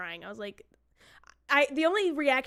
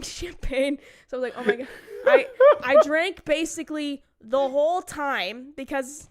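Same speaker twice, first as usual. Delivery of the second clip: laughing through the words, louder and slowed; 2 semitones higher, 8 dB louder than usual. Real, not acted. Bride recalling morning champagne to her co-host, laughing louder. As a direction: giggle it out, louder.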